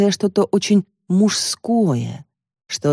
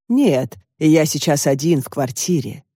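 ordinary delivery

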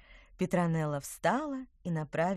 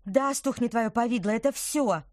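curt, snappish reply; sharp retort; 5 semitones higher, 7 dB louder than usual. Acted. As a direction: snap it out sharply. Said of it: "резко выдала".

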